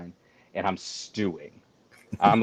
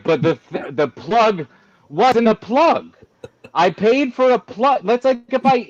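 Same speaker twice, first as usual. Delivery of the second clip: exasperated rant; 8 semitones higher than usual. Retold or imitated. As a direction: pitch up and protest like you've been cheated. Ranting at dealership staff, exasperated.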